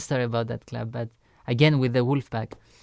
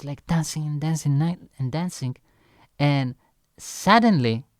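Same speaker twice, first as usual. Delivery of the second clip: ordinary talk, nothing marked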